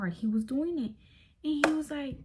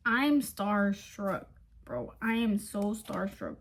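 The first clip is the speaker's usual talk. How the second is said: usual speaking voice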